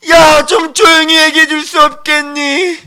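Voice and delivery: high, strained mock complaint; exasperated plea. God asks for less noise.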